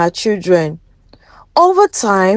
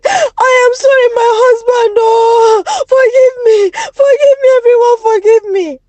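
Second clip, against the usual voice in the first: much higher, loud sobbing wail; wailing confession; 11 semitones higher, 3 dB louder than usual. Acted. Guilty wife confessing to husband and village, sobbing loudly. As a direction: break down and beg through tears. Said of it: "she began to wail".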